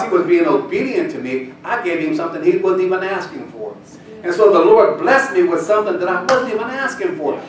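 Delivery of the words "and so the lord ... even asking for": preaching louder and higher as God speaks; rising preaching. Acted. Preacher voicing God's blessing to the congregation, building loud.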